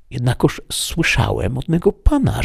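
a hint of a pleading peasant asking a favour; Sancho the squire. A narrator's reading. Sancho asking the housekeeper to stable his donkey, lightly pleading.

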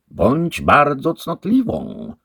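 wolno, wyżej i głośno, dostojnie; głos głowy. A narrator's reading. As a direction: poucza z namaszczeniem. The head answers. (głowa poucza damę)

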